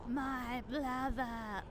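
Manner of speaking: zombie voice. Pretend. Voicing zombie again.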